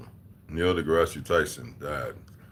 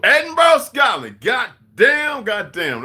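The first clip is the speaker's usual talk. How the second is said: very high, loud whooping shout; excited hollering; 7 dB louder, slower than usual. Real, not acted. Streamer cheering his place in Scotland, excited yell. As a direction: holler it with real delight.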